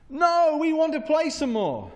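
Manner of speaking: put-on high, loud child's shout back; protesting children. Kids in the garden answer parent, shouting in protest. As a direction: holler a refusal like a kid at play.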